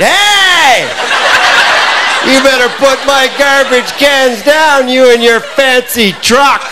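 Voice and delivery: put-on high, slow belligerent drunk shouting; hammed-up furious drunk hollering at a truck; drunken angry yell. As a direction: roar at the neighbour like a loaded drunk. Drunk yelling at neighbour.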